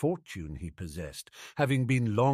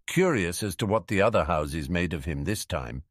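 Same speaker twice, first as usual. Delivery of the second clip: ordinary delivery, nothing marked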